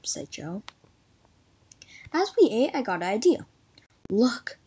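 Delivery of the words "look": ordinary delivery, nothing marked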